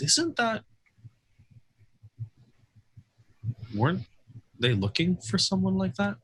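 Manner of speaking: high-pitched, hesitant question with long gaps; not whispering. Acted. Lucky asking Levi within everyone's hearing, not whispering. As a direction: lift it high and break off before finishing. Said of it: "Lucky is not whispering".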